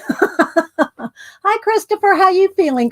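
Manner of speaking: light up as a regular joins the stream; excited greeting. Real, not acted. Streamer greets returning viewer Christopher, excited and high.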